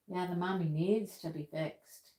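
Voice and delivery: normal talking voice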